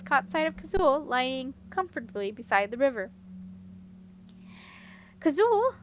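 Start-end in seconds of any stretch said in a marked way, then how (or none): 5.2-5.7 high, loud cry of a name; a girl running to her friend, crying out. A narrator's reading. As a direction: call out to her in alarm. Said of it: "Simran cried and ran forward".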